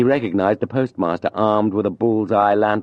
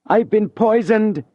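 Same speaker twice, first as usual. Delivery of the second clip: much higher, slow, hammed-up cry of poison; faked shout; 14 semitones higher, 4 dB louder, slower than usual. Julien pretending poisoning to wake the house, hammed-up shout. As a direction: fake the agony to wake the house. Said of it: "he shouted".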